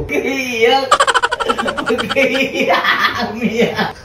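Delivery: high-pitched words through laughter; laughing. Real, not acted. Prankster teasing the hurt friend for crying, laughing. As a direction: crow it out, laughing hard. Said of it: "tawa ka pa ng tawa".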